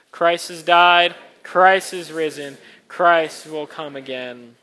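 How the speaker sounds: loud, slow proclamation; liturgical proclamation. Acted. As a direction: proclaim each line. Congregational proclamation.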